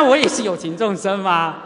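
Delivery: ordinary delivery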